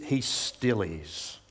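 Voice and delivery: quiet and very slow, weighing each word; preacher affirming God's presence today, slow and soft; slow emphasis. Shown as a demonstration. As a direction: land it gently and let it sit.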